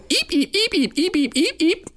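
very high rapid eeps; imitated high-pitched squirrel chatter; squirrel squeaks. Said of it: "a squirrel sound is eep".

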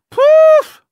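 a sudden very high, loud "Poof!" burst; verbal wolf whistle. Shown as a demonstration. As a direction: blurt "Poof!" like a wolf whistle at someone hot. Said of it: "but more like".

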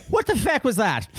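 high-pitched Irish outrage; mock Irish accent. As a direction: play an appalled Irish listener. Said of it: "reading something in my Irish accent".

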